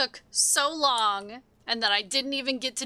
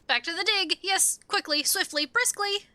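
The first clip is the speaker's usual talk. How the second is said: higher, excited kobold voice; urgent kobold orders; 9 semitones higher than usual. Acted. Kobold sending the diggers back to work, urgent. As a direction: hurry the diggers along, pitch climbing.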